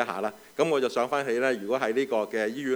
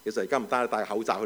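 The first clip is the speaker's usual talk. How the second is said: ordinary talk, nothing marked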